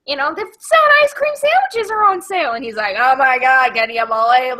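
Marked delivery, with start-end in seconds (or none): 0.1-2.5 high, loud nagging wife voice; hammed-up oblivious wife, pitched way up; Chris Farley as the wife. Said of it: "Chris Farley's playing the wife". 3.0-4.6 mildly annoyed husband; put-on irritation at the wife; Adam Sandler. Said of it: "he's, like, so annoyed".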